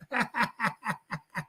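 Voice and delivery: tiny chipmunk chuckle; chipmunk laugh. Shown as a demonstration. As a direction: laugh like a sped-up cartoon. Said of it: "Alvin and the Chipmunks".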